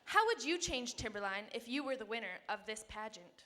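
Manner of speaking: normal talking voice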